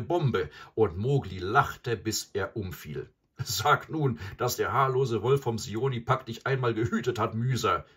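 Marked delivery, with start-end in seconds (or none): 3.4-7.9 loud, raised taunting call; raised voice, loud and higher. A narrator's reading. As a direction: call out to the buffalo. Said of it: "rief er".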